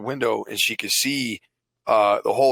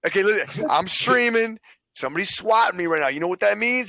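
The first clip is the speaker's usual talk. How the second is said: high, hurried pleading voice; urgent explanation to cop; 7 semitones higher than usual. Retold or imitated. Streamer explaining the swatting to the cop, high and pressing. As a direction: talk the cop down, pitched up.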